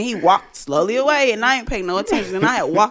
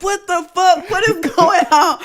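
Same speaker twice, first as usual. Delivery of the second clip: higher, louder, laughing alarm; hyped laughing; 10 semitones higher, 6 dB louder than usual. Retold or imitated. Getting hyped by the cold can, laughing alarm. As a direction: laugh through the panic. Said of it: "I was like".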